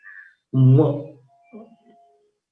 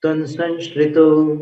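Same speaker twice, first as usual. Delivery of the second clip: verse recitation; 5 semitones higher, slower than usual. Shown as a demonstration. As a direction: recite each line with care.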